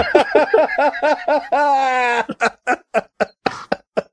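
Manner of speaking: long, high-pitched laugh; helpless high laughter that keeps going; real laughing fit. Real, not acted. Cracking up at co-host's remark about the dogs, laughing.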